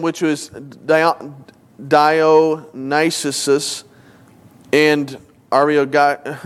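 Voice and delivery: very slow, sounding out hard names; halting reading. Real, not acted. Preacher reading verse thirty-three, stumbling haltingly. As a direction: pick through the names slowly, breaking off.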